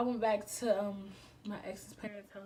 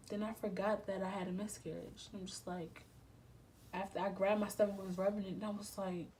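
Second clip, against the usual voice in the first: usual speaking voice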